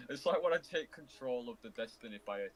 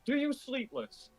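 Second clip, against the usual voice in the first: mock high voice; 12 semitones higher, 4 dB louder, slower than usual. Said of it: "if I'm like".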